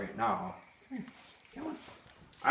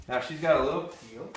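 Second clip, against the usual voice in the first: usual speaking voice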